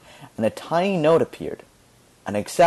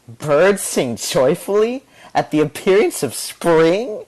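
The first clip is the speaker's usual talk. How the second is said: slow, higher, sneering read; mocking question; 5 semitones higher, 3 dB louder than usual. A narrator's reading. Violinist mocking Candida's poetry, slow sneering question. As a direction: read it aloud to ridicule her. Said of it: "asked the violinist".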